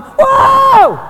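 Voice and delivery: high shouted whoa of wonder; loud, high cry of awe; amazed whoa. Pretend. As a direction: belt out a stunned whoa. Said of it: "gonna be going".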